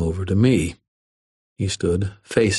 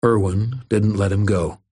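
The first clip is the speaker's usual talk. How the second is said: usual speaking voice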